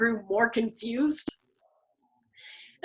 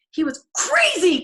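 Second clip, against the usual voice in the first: slow, loud high shriek; 7 semitones higher, 8 dB louder than usual. A narrator's reading. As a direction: shriek the accusation.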